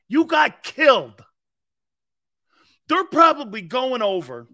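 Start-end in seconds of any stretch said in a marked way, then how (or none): 0.1-1.2 loud slow coach bark; mock O-line coach chewing them out; imitating coach. Imitating Stoutland yelling at his linemen, loud and slow. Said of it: "Stoutland's saying to his O-line".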